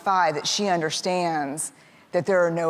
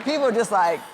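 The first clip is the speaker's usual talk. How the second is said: normal talking voice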